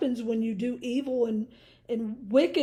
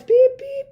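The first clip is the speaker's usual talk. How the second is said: imitated backup beep; 12 semitones higher, 9 dB louder than usual. Imitating truck backup alarm.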